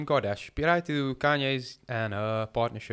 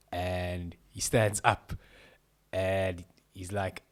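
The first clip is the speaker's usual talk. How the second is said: ordinary talk, nothing marked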